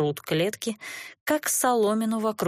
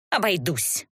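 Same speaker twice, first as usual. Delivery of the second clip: curt, mildly snappish refusal. A narrator's reading. Injured sorceress refusing help, mildly snappish. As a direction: brush the offer off with slight irritation. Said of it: "огрызнулась магичка".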